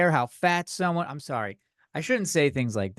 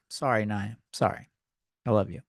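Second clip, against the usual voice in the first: soft apology; 7 semitones lower, 3 dB quieter than usual. Real, not acted. Apologizing to listening girlfriend Anaya.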